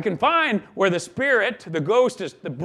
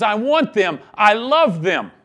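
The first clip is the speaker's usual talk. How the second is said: louder, full-hearted insistence; fervent declaration; 3 semitones higher, 7 dB louder than usual. Acted. The Father claiming his sinful people, louder and fervent. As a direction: proclaim it with a father's longing.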